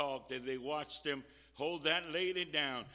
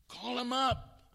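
louder, higher, slow command; urgent urging; 9 semitones higher, 9 dB louder than usual. Real, not acted. Preacher pushing listeners to invite just one, urgent urging. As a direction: urge them firmly with raised voice.

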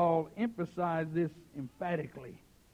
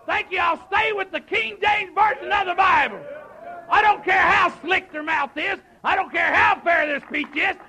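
high, shouted pulpit declaration; full-force preaching; 11 semitones higher, 13 dB louder than usual. Acted. Preacher standing by the King James Version, full-force preaching. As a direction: drive each refusal home, building to the last.

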